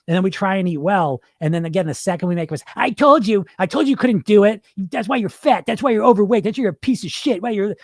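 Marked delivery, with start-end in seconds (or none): 2.8-7.7 high, scolding self-attack; acted-out harsh inner critic; mean critical inner voice. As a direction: berate yourself, pushed higher, a little short of full meanness. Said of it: "not gonna go as mean as it gets".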